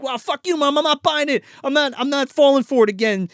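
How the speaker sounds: put-on high whiny defiant kid; high angry teen. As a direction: snap back like a sulky son. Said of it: "he's like".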